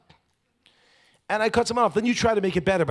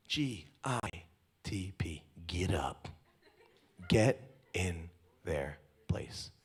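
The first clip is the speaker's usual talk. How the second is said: slow unvoiced whisper; 8 semitones lower, 6 dB quieter, much slower than usual. Shown as a demonstration. Whispering the slogan to the congregation. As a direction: breathe it out slowly like a secret.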